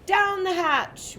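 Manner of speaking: high, slow rowdy cheer; crew shout. A narrator's reading. The crew answers the toast, slow high shout. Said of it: "we all shouted".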